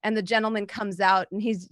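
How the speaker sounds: usual speaking voice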